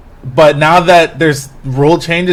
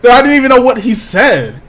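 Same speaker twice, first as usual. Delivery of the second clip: laughing complaint; 6 semitones higher than usual. Real, not acted. Pranked co-host excusing himself, laughing in protest. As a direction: complain while cracking up.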